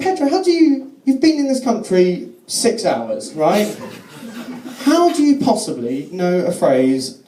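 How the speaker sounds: retold astonishment at a friend; baffled disbelief. Acted baffled reply to Pedro.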